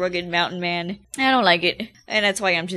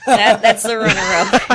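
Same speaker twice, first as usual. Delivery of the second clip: laughing through the words, a bit louder; 7 dB louder than usual. Real, not acted. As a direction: giggle it out as the memory hits. Remembers awful haircut, laughs.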